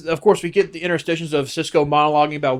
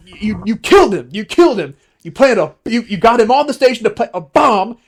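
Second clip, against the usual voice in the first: loud, raised accusing voice; angry accusation; 6 semitones higher, 5 dB louder than usual. Retold or imitated. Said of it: "he was like".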